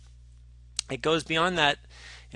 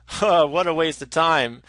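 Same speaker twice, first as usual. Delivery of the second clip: loud, higher, dismissive scoff; blurted out; 5 semitones higher, 10 dB louder than usual. Retold or imitated. Bill Murray blurting a rude dismissal at his date, loud. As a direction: blurt it out without thinking. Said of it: "he blurts out".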